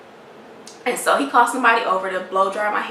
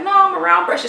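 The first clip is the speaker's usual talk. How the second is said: higher, louder stubborn refusal; raised-pitch, firm contradiction; imitated insistence; 9 semitones higher, 5 dB louder, much faster than usual. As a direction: mimic someone digging their heels in. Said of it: "So she's insistent".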